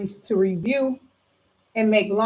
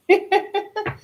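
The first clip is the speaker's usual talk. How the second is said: laugh; 12 semitones higher than usual. Real, not acted. Delighted over good cards.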